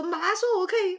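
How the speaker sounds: put-on high, whiny kid pleading with mom; childish protest. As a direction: raise it like a kid arguing back. 小孩跟妈妈争辩. (像小孩一样高声辩解)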